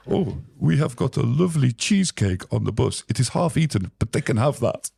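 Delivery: brightly eager to help, mock-helpful; Swedish guitarist. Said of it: "Björn, the Swedish guitar player".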